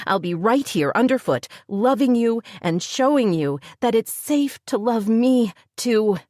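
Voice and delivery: a woman insisting she loves him, rising; Heather declaring love. A narrator's reading. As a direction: swell into a heartfelt declaration. Heather pleads to Jim.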